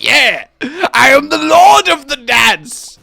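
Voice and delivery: high, loud boast; mock triumphant shout. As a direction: proclaim it with hammed-up glory. Imitates childhood dancing triumph.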